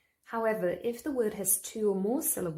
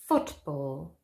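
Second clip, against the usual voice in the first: football with FOOT hit hard and ball dropped away; FOOTball, first syllable stressed; 5 semitones lower than usual. Shown as a demonstration. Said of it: "Stress on the first syllable".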